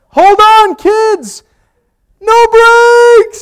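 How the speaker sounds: high, loud shrill-free panicked cry; panicked mother. Retold or imitated. Mother to kids in back seat, panicked loud cry. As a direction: warn the kids the car may crash.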